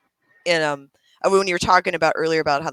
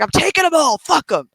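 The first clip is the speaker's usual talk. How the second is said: high, loud mock battle cry; fierce trash-talk imitation; in the zone; 10 semitones higher, 4 dB louder than usual. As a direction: imitate a gamer fired up on a rampage. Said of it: "He had a whole nother voice".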